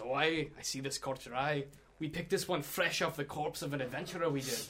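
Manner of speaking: lightly voiced rustic shopkeeper; dwarf shop hand. Shop hand approaches a customer, chatty dwarf voice. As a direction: sell it in a dwarf voice. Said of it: "the shop hand approaches you and says".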